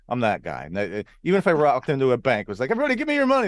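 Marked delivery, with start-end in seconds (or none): 2.7-3.5 high, loud hold-up cry; mock robber's demand. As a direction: play at robbing a bank. Imagined bank robber's demand.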